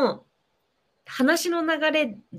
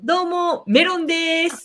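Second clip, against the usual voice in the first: quoting the guest's intro, higher and louder; 5 semitones higher, 5 dB louder than usual. Retold or imitated. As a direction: echo the guest's deadpan self-intro. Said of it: "ちくわさんの冒頭の自己紹介".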